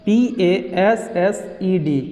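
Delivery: each letter spelled out slowly and separately; slow spelling. Shown as a demonstration. Teacher slowly spells out the word for students. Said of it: "spelling out passed".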